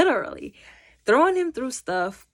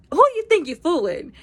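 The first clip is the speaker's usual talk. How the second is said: high, louder mock suspicion; suspicious challenge; 9 semitones higher, 6 dB louder than usual. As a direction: call his bluff. Friends challenging the new Peter.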